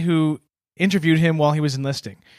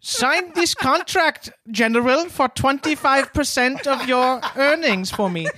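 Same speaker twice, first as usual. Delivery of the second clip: schemer voice; 7 semitones higher than usual. Acted. Acted Parker pitching contract.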